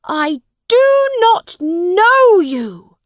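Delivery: high, loud, indignant prince; angry. A narrator's reading. Prince disowning the woman claiming him, angry. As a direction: reject them slowly in a high, outraged cry. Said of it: "said Edward angrily".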